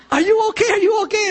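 high, anxious repeated question; teen girl checking on the man she hit; worried girl. Retold or imitated. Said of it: "she asks".

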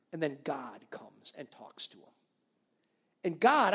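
ordinary talk, nothing marked

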